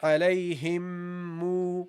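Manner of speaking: "Alayhim mu" slow, the m held into mu; slow nasal link. Shown as a demonstration. Teacher links the words for students, slow. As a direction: hum the m into "mu". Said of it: "and then mu".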